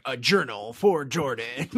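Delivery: slow, dramatic trailer announcer; horror trailer voice. Retold or imitated. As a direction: intone the title like a trailer. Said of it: "you don't have Denzel going".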